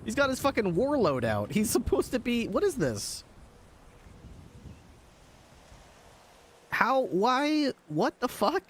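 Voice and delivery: rising shocked disbelief; disbelieving. Real, not acted. Shocked at clip.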